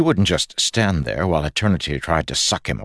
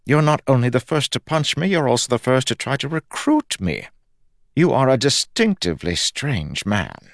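higher-pitched, fussy god voice; bemused Fuzz; 8 semitones higher than usual. Acted. God sizing up the man recruiting him, bemused. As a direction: marvel at this odd man in a higher voice.

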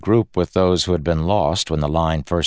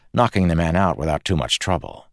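plain reading voice

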